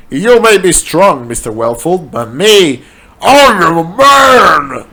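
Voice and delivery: lightly voiced mock hero; heroic defiance. A narrator's reading. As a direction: stand tall before the monster. Hero defies the werewolf.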